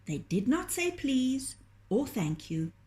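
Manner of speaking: ordinary delivery, nothing marked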